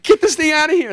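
high, loud mock panic; frantic plea. Gath's people wanting the Ark gone, high panicked mock shout. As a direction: shriek it like a scared townsman wanting it gone. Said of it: "the Gath is like".

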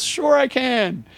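high, drawn-out reply; eager agreement. Retold or imitated. Storyteller agreeing to email his friend, eager. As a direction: stretch it out, brimming with willingness.

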